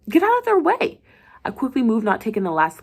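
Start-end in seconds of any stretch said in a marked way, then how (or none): 0.1-1.0 high, loud command; urgent yell. Acted. Nurse ordering the doctor aside, yelling. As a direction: clear the hallway.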